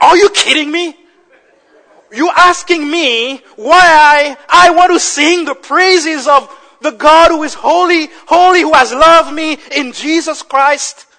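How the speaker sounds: loud, high, incredulous retort; impassioned preaching. Real, not acted. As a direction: fire the answer back from the pulpit. Preaches at full force.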